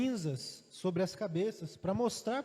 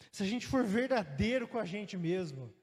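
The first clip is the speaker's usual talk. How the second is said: ordinary talk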